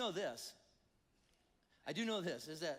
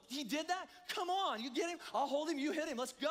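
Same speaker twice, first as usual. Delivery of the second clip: high-pitched, mock-outraged call to a fight; rally a buddy for a scrap, pitched up and worked up; indignant egging-on; 7 semitones higher, 4 dB louder than usual. Pastor acts urge to fight.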